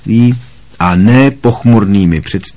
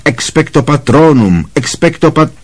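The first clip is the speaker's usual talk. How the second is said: higher, desperate spell cut off; repeated spell, pitched higher and strained; rising shriek; 7 semitones higher than usual. A narrator's reading. Harry shrieks spell again, cut off.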